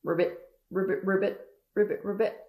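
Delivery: quick run of imitated frog ribbits; clipped, repeated frog call; frog croaking. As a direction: croak rapidly like a little frog. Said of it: "And it goes".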